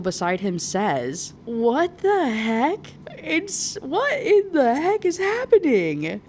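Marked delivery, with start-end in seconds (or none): 1.4-6.2 high, drawn-out disbelief; incredulous outburst. Acted. Reader reacting to the gargoyle's reveal, incredulous. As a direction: stretch it out in high-pitched astonishment.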